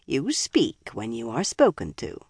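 low, soft icy rebuke; cold grandmotherly rebuke. Acted. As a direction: chill her with calm menace. Joya rebukes coldly.

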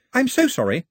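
put-on high, flustered girl voice; Daphne apologizing. A narrator's reading.

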